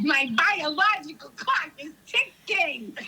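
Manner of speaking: put-on slow New York movie accent; Marisa Tomei. Quoting a favorite My Cousin Vinny part, mock New York accent. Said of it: "mental picture of Marissa Tomei".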